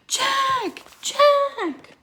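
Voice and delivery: high, loud calls into the wind; calling out. Acted. Jack calling for his lost sister in the storm, high shout. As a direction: shout her name across the snow.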